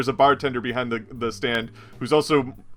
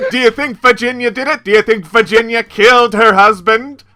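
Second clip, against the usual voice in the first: higher, louder, prying reporter; pushy reporter; 9 semitones higher, 9 dB louder than usual. Acted. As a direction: press hard for a scoop. Reporter NPC presses again.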